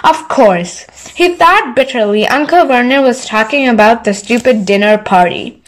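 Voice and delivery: usual speaking voice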